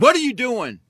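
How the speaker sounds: retold upset demand, pitched high. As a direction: act out an alarmed, indignant challenge. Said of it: "very upset".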